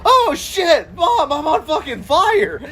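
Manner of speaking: laughing yell of disbelief; panicked yell. Retold or imitated. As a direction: shriek it out in mock panic, laughing through it. Retold panic as car catches fire.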